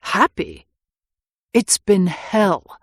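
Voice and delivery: slow, heavy retort; Harry bitterly correcting Anne. A narrator's reading. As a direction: spit out the word hell.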